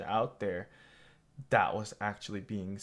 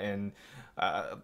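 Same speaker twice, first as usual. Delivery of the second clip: usual speaking voice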